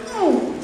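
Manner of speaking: high, sympathetic oh of pain; a high, hurting gasp of Oh. Shown as a demonstration.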